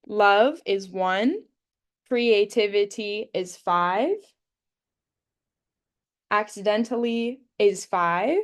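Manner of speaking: slow, deliberate sorting read-out; slow teacher modelling. Shown as a demonstration. Teacher sorting words aloud for students, slow and deliberate. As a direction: count each word out for the class.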